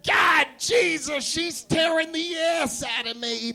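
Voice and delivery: slow, strained high cry of pain; pained shout. Acted. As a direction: yell while being bitten. Puppy bites Bill.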